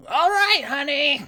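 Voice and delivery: very high, loud call; half-orc answering from the porch; calling back. Acted. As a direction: yell it back fondly.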